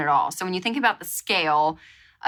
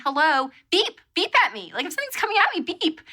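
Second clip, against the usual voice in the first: mock scolding; 9 semitones higher, faster than usual. Scolding the van.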